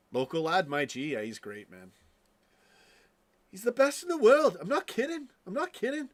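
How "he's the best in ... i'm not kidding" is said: much higher, mock Scouse impression; hammed-up, squeaky attempt at Scouse; bad Scouse accent. Streamer mimicking the local lad he calls great, high fake Scouse accent. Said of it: "Can't do a Scouse accent to save my ass".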